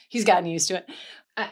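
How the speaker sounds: ordinary delivery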